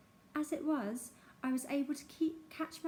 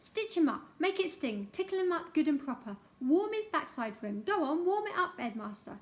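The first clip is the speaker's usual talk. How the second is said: higher and louder, fast spiteful egging on; shrieking; 4 semitones higher, 4 dB louder than usual. Acted. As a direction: whip the headmaster on like a crowd. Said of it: "shrieked Missus Pratchett".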